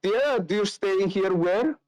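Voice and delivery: put-on dim drunk. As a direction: slur a confused question. Carl mumbles drunkenly.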